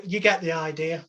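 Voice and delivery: normal talking voice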